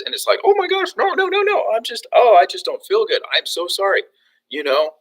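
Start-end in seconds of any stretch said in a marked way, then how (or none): 0.4-4.0 high-pitched, gushing protest of innocence; acted-out alarmed backpedaling; flustered apology. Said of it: "I get accused of being angry".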